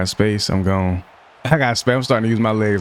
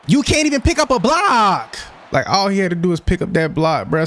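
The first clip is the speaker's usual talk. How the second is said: frustrated yell; 11 semitones higher than usual. Real, not acted. Gamer yelling at his blocker for missing a block, exasperated. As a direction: let the frustration explode, pitched way up.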